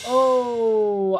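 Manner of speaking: ordinary talk, nothing marked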